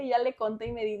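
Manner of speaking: usual speaking voice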